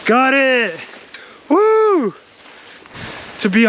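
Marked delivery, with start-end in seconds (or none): 0.1-2.1 excited whoop. Real, not acted. Reaching the summit, cheering.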